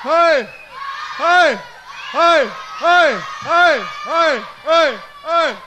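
loud, high, cheerful hoi; shouted greeting. Real, not acted. Host leading the audience in a loud greeting. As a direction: shout hi to the whole hall.

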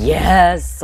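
loud, high victory yell; triumphant shout. Real, not acted. Gamer celebrating a kill, triumphant shout.

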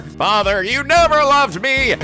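loud, high, slow wail; hammed-up cry of betrayal; dramatic outcry. Recap skit, son cries out.

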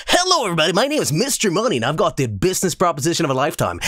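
high, smug pitchman voice, slowing to a fast close; oily salesman selling the deal of a lifetime; hammed-up sleazy huckster; asshole voice. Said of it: "I gotta activate my asshole voice".

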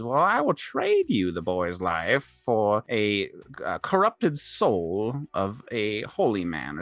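put-on slow, drawn-out old hag. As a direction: bargain slowly like a scheming hag. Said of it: "the hag says".

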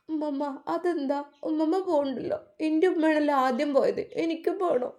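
put-on small girl arguing through sobs, pitched high; sobbing little girl. A narrator's reading. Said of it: "വിതുമ്പി കരഞ്ഞുകൊണ്ട് നിൽക്കുന്ന ആ കുട്ടിയെ".

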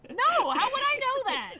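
high, protesting squeak; indignant disbelief. Real, not acted. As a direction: defend yourself, aghast. Indignant at not knowing.

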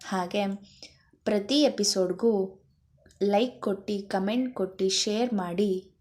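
friendly host's welcome and subscribe appeal; a host greeting viewers before the chapter; channel host intro. Acted. Host welcomes listeners and asks them to subscribe, in a friendly announcer voice.

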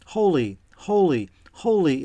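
understated reverent exclaiming. A narrator's reading. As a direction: lift each word in measured acclaim. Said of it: "they do not stop exclaiming".

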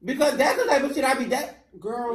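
camp it up like a gossiping diva; sassy diva voice. Acted. Sassy gossip reply to friend.

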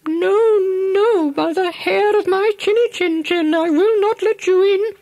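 very high, quick little piglet refusing; squeaky defiant pig. Acted. Second pig refusing the wolf at the door, very high squeaky piglet voice. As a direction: stand firm in a tiny pig voice.

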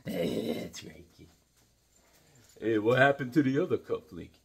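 put-on an old trainer talking gently to his fighter; lightly voiced old trainer, heartfelt; Mickey, fond talk. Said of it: "We'll do a little Mickey".

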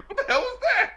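very high, baffled mock outcry; hammed-up shocked reaction; startled viewer. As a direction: squeal it in mock bewilderment.

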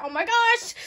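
high, loud squeal of delight; thrilled cry of joy; excited squeal. Real, not acted. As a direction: squeal it out, overjoyed. Overjoyed unboxing exclamation.

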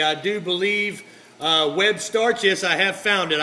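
ordinary talk, nothing marked

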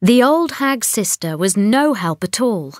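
ordinary delivery, nothing marked

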